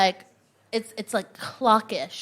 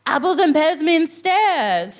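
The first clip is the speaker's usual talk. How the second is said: slower, louder, savouring; slow amazed repeat; 5 semitones higher, 4 dB louder, slower than usual. Shown as a demonstration. Comic repeating the slang back to the crowd, slow. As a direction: repeat it slowly in wonder. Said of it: "repeats slang".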